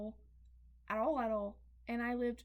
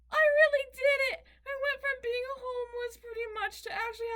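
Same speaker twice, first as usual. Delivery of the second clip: very high, loud, overjoyed crying; tearful joy; 10 semitones higher, 7 dB louder than usual. Retold or imitated. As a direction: cry out in disbelieving happiness. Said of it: "all of a sudden it was".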